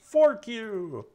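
high-pitched, loud character battle cry; mock yell. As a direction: yell it high, stretching the words out. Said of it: "That's what he's gonna yell".

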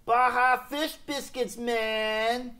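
high, drawn-out California surfer voice. Acted. [name] doing the surfer voice Frank asked for. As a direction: surfer dude, stretched and laid back. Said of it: "Say it in the surfer voice".

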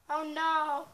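ordinary delivery

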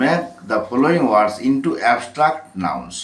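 English prompt read very slowly, word by word; slow reading of the question. Shown as a demonstration. Teacher dictating the question, very slowly. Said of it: "দশ নম্বর দেখুন".

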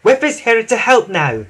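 high, lively engine voice; Emily. A narrator's reading. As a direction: announce the help up high. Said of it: "tooted Emily".

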